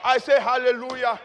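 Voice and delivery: loud shouted hallelujah; insistent praise call. Real, not acted. Preacher pressing the congregation for a response, insistent shout. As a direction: demand the congregation's response at full voice.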